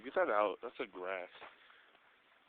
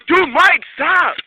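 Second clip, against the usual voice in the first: shouting; 8 semitones higher, 9 dB louder, much slower than usual. Real, not acted. Yelling at friend in panic.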